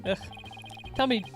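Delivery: ordinary talk, nothing marked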